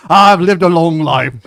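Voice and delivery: loud, slow, high-pitched boozy boast; big drawn-out pirate voice; drunken pirate vampire. Acted. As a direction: bellow it like a tipsy pirate. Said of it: "sounds like a pirate".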